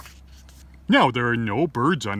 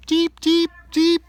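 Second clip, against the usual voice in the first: squeaky high peeping; high chick peeps; 6 dB louder than usual. A narrator's reading. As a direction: peep like baby chicks.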